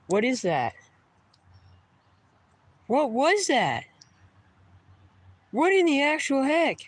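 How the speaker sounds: real frightened yelp; freaked-out cry. Real, not acted. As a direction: yelp it as if something lunged. Creature swimming toward him.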